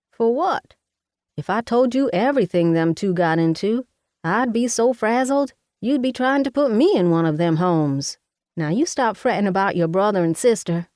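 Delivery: a bossy Southern mama fussing over her daughter; Mama, Southern mother. Acted. Mama voiced in the story.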